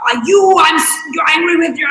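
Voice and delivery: mock angry accusation. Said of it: "when we project it on the outside".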